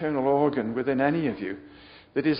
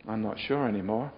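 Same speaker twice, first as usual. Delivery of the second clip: ordinary talk, nothing marked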